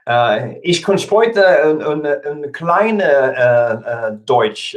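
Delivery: slow, halting fake German; mock German phrase, high and loud. Shown as a demonstration. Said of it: "I speak fake German".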